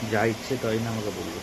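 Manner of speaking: normal talking voice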